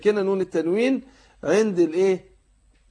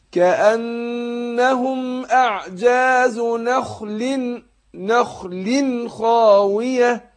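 slow chanted tajweed recitation, heavy nasal hum; Quran recitation; 6 semitones higher, 3 dB louder, much slower than usual. Shown as a demonstration. Teacher demonstrating recitation to students, slow chant. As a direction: recite slowly, drawing out the ghunna.

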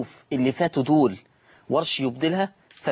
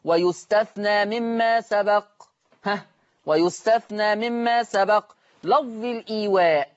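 slow, higher chanted rule, as a memorised line; recited rule text; 7 semitones higher, slower than usual. Shown as a demonstration. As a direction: intone the rule like a recitation primer. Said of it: "فبنقول".

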